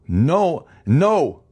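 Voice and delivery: high, emphatic denial; adamant refusal. Acted. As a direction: cut off the plea forcefully. Husband refuses her pleas.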